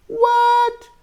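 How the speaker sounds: high shocked what. Acted. Storyteller shocked that nobody eats cake. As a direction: sound outraged and surprised.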